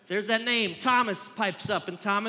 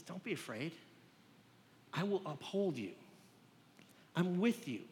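reassuring God; 7 dB quieter than usual. Acted. God reassuring fearful people.

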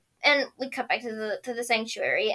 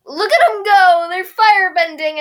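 excited; 7 semitones higher, 11 dB louder than usual. Real, not acted. Excited commentary.

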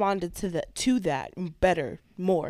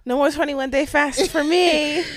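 loud, high and slow; joyful exclaim; 8 semitones higher, 9 dB louder than usual. Real, not acted. Wife gleefully done with long fasts, loud high slow. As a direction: celebrate every word. Joking relief at ending fasting.

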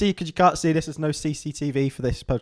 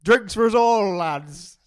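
hearty host; 6 semitones higher, 5 dB louder, slower than usual. Acted. Voicing Wes in story.

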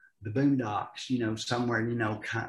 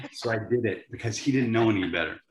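normal talking voice

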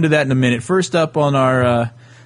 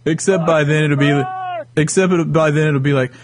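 usual speaking voice

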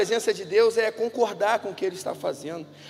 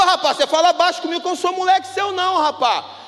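loud, high, fast furious rant; angry mechanic shouting at a young customer; mechanic yelling; 9 semitones higher, 6 dB louder than usual. Retold or imitated. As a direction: bark it at the kid like an enraged mechanic. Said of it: "gritava comigo".

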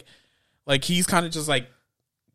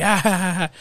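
mock laugh; 6 semitones higher, 3 dB louder than usual.

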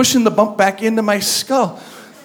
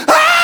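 high mock scream of fright; a sudden retold shriek of fright; startled scream; 5 dB louder than usual. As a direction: shriek like she saw a ghost. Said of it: "she came around the corner and she went".

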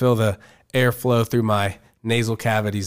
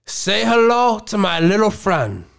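slow, high, hammed-up gangster; Scarface gangster voice; 9 semitones higher, slower than usual. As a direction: growl the line like Tony Montana. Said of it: "I'm gonna do my best to portray the voices".

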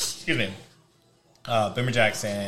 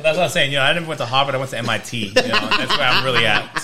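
louder hammed-up Boston bragging; Boston accent; 2 semitones higher, 5 dB louder than usual. Joking with friends about MIT, in a hammed-up Boston accent. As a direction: brag like a Boston guy who thinks he's wicked smart.

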